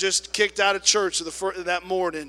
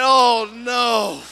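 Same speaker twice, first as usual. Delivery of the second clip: loud, higher oh no; mock mortified shout; 5 semitones higher, 11 dB louder than usual. Attendant cries out as pastor tells the story. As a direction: cry out in embarrassment. Embarrassed reaction.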